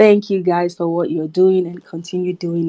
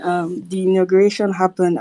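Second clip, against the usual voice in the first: usual speaking voice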